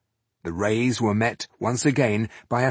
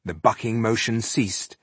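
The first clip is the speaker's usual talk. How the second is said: ordinary delivery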